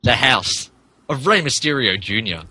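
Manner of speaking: ordinary delivery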